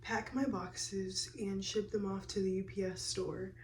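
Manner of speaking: keeping voice down early morning; hushed, careful murmur; hushed talk. Real, not acted.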